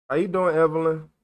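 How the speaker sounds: ordinary talk, nothing marked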